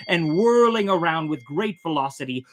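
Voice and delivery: ordinary delivery, nothing marked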